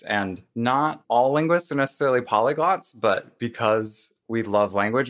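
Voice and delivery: ordinary delivery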